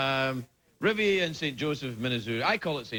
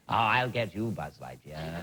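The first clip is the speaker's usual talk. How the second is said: energy vampire villain voice; 10 semitones lower than usual. Shown as a demonstration. Villain threatening Buzz Lightyear, low hammed-up menace. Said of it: "a voice that talked like this".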